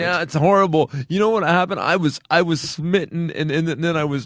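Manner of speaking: high, hammed-up wounded complaint; mock self-pity. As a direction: play a lightly mocked rejected kid. Mocks self-pitying rejected kid.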